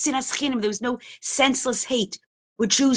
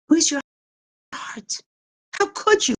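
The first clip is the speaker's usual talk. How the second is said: anguished reproach; 8 semitones higher, 3 dB louder than usual. Retold or imitated. Mother berates rabbi who burned model.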